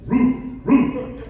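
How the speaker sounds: loud, high barking roof roof; big dog bark. Retold or imitated. Talking dog answers the riddle with a loud bark. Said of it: "he let out a big old".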